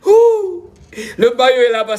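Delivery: high, loud whoop pointing out the jersey; excited whoop. Real, not acted. Streamer showing off the Ivory Coast jersey, thrilled. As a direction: hoot with glee at the shirt.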